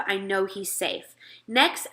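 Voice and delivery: usual speaking voice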